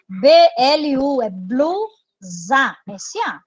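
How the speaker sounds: letters B L U spelled one by one, then "blusa" drawn out slowly. Shown as a demonstration. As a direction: spell it out slowly like a teacher at the board. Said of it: "Esse B L vai ficar na mesma sílaba".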